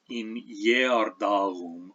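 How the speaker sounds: Jerdalum starting with a Y sound, soft throaty G later; slow Old English Jerdalum, Y-like first G; palatal and soft G. Shown as a demonstration. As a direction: glide into Jerdalum with a Y. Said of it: "other two pronunciations of the G".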